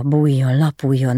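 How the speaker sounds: usual speaking voice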